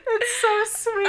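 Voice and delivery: high, loud cooing; gushing. Real, not acted. Host agreeing about the doll scene, gushing coo. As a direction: gush at full tilt, high-pitched and loud.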